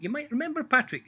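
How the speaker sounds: Michael cheekily reminding the devil; knowing hint. Acted. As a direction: pitch it up, cheeky.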